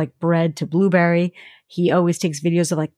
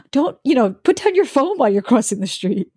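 lightly voiced mom, gasp then higher warning; worried mom; 5 semitones higher than usual. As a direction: gasp and gently scold, imitating a mother.

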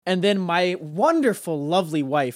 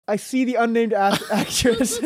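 higher voice, cracking up mid-word; real giggling breakdown; 7 semitones higher than usual. Real, not acted. Storyteller naming the unnamed actress, breaking into real laughter. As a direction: lose it laughing as you speak.

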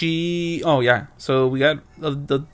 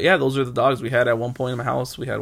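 usual speaking voice

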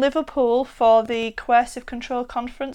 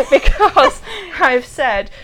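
laughing; 7 semitones higher, 10 dB louder than usual. Real, not acted. Interrupted, both laughing.

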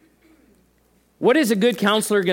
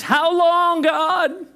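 much higher, strained plea; high lament cry; 12 semitones higher, 4 dB louder, much slower than usual. Acted. Preacher crying out a lament to God, high and strained. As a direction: wail the question upward.

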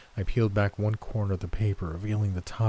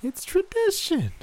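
put-on high, hard old woman; sweet menace; high menacing granny; 3 dB louder than usual. A narrator's reading. Gran voiced, menacing.

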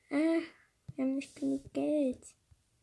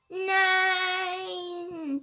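dismayed cry; 8 semitones higher, 6 dB louder than usual. Real, not acted. Lost the game, outcry.

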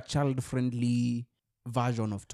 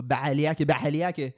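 imitated Somali accent; 2 semitones higher, 3 dB louder than usual. Co-host hit with the Somali accent after a film, imitating it. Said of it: "I was hit with the Somali accent".

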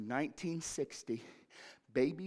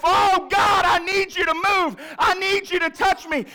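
high, loud cry to God; a desperate loud prayer of longing; shouted prayer; 14 dB louder than usual. Acted. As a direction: plead out loud with everything. Voicing a congregant's prayer.

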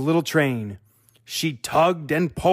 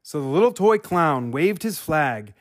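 ordinary delivery, nothing marked

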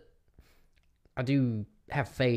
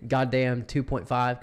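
slow announcement; 5 dB louder, slower than usual. Pretend. Naming the recording slowly.

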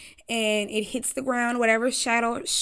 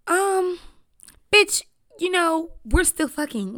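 put-on higher, louder sassy girl comeback; sassy retort; 7 semitones higher, 5 dB louder, slower than usual. Kelsey snapping back at Meg in the car, sassy. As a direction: snap back like a friend caught off guard.

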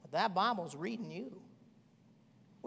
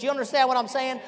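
ordinary talk

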